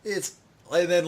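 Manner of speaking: ordinary delivery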